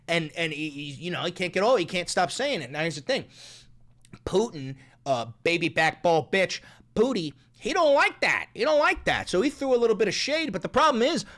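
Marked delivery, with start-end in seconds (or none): none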